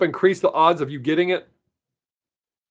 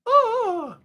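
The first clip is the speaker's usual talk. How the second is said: excited squeal. Real, not acted. Delight over new earrings.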